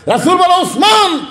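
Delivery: retold, high-pitched insistent summons; emphatic repeated call. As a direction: lift the name high to press the point. Third asking, at its peak.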